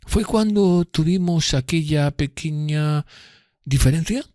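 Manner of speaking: slow, high, mocking insinuation; voicing Drumley's slow mocking jab. A narrator's reading. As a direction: drag out the jab. Drumley needles narrator.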